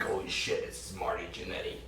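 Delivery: awed hush. Retold or imitated. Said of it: "I'm like".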